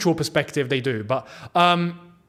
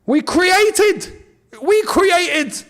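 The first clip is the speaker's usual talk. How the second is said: elated shout; 9 semitones higher, much slower than usual. Real, not acted. Fan cheering his club's chance, high joyful cry. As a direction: crow with delight at the breakthrough. Celebrating the team's first chance.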